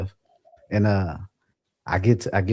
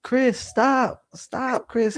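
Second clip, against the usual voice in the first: high-pitched imitation of a pleading lady; woman calling out; 13 semitones higher, much slower than usual. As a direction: call out to stop him, voice pitched up. Lady calling out to stop him.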